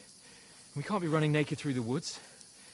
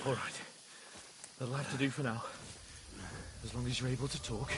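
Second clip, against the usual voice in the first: soft and reassuring; 6 semitones lower, 8 dB quieter than usual. Acted. Comforting a wounded friend.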